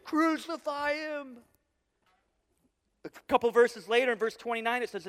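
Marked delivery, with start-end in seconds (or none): 0.0-1.4 high, drawn-out cry of a baying crowd; pitched-up, slow crowd chant for blood; bloodthirsty mob yell. Acted. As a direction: imitate a screaming mob, stretching out the words. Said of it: "screaming at the top of their lungs".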